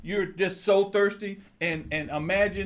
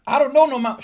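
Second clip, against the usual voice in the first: high, loud, put-on voice of a doubting mind; the distracted mind refusing God's order; doubting inner voice; 8 semitones higher, 11 dB louder than usual. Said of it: "you hear God says".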